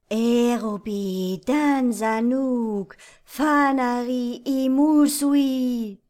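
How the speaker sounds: slow, loud spectral chant; ghostly incantation. Acted. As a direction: intone it like a chorus of spirits. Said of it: "Die Stimmen raunten sich".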